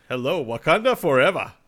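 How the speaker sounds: a hint of a put-on Wakandan accent. Shown as a demonstration. Showing his accent practice to co-hosts, light Wakandan accent. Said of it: "there's Wakandan from the movie".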